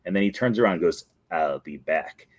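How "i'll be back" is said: Terminator reference. Retold or imitated. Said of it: "as a reference to the Terminator".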